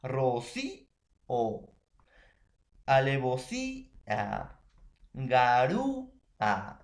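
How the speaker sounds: "Rocío", "alevosía", "garúa" drawn out, holding í and ú before a separate last vowel; stressed closed vowel. Shown as a demonstration. Teacher listing hiatus examples, stressed vowels held in "Rocío", "alevosía", "garúa". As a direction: hold the í in "Rocío" and "alevosía", the ú in "garúa", then detach the ending. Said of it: "ahí se va a separar".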